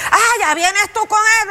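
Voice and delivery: high-pitched mock annoyance; indignant debtor. Preacher playing a debtor asked for the twenty dollars, mock indignant.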